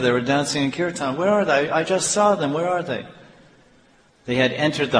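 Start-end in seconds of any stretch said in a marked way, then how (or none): 1.2-3.0 higher-pitched bewildered questioning; mild, acted alarm at something missing; urgent searching. Devotee searching for the deities he just saw, urgent questioning.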